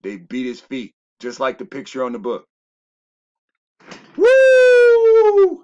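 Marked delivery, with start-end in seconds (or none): 4.2-5.6 long, loud, high "woo" holler; high whoop. Real, not acted. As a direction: let out a piercing whoop. Excited outburst at the picture.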